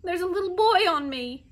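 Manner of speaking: high, loud cries of distress; shouting for help. A narrator's reading. Toppled bee with a boy on top, shouting for help. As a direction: shout for rescue, pitched well up. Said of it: "lay there shouting".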